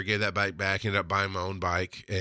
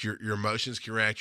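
ordinary delivery

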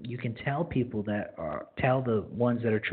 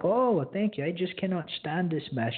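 a light, imitated Mennonite accent, pitched higher; 7 semitones higher than usual. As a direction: mimic the cleaning lady with a mild Mennonite accent. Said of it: "I make a really bad, um, Mennonite accent".

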